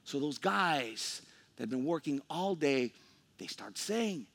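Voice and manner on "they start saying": ordinary talk, nothing marked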